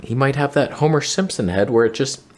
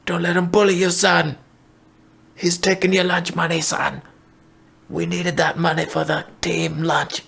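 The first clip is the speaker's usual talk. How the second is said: a hint of a pushy cornerman, higher; light mock coach urging his fighter on; corner coach pep talk; 6 semitones higher than usual. Coach in the corner urging his fighter to get the money back, lightly voiced. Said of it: "getting a pep talk from the corner".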